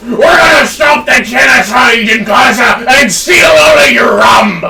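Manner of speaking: hammed-up shouting sea dog; mock pirate battle cry. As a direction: bellow it like a cartoon pirate. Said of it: "It's a pirate voice".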